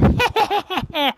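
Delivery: very high evil cackle; witch cackle. Acted. Witch laughs after spell.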